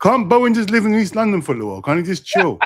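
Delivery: playful high-pitched exasperation; exasperated plea. Real, not acted. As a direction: plead on his behalf, high and loud. Playful pleading against teammate's repeated pick.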